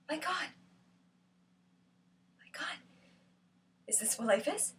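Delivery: very soft, fading exclamation; small, hopeless murmur; hushed despair. Acted. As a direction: breathe it out quietly, pausing between. Quoted despairing thought.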